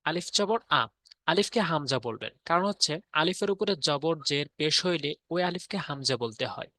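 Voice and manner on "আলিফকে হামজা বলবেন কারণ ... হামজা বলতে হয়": ordinary talk, nothing marked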